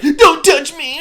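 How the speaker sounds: high, loud, upset whine; Mutt, annoying. Retold or imitated. Mimicking an upset character fending people off, annoying screech. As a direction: screech it in an obnoxious, hammed-up voice. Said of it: "he has this really annoying voice, and he's very upset".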